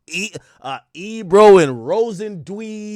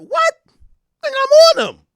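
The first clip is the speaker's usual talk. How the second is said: way higher, louder what, then insist; outraged disbelief; 7 dB louder than usual. Real, not acted. Outraged at a hater's claim.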